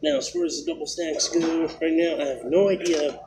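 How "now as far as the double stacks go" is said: normal talking voice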